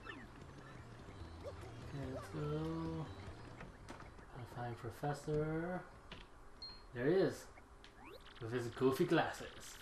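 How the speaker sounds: normal talking voice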